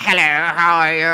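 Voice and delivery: low, refined yogurt voice; posh yogurt voice. Acted. Yogurt greeting the fridge, low cultured voice. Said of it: "yogurt will be like".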